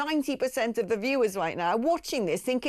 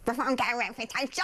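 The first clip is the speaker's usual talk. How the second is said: mocking the interviewer; 2 semitones higher, faster than usual. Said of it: "Oh, shut up!".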